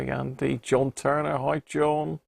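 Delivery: usual speaking voice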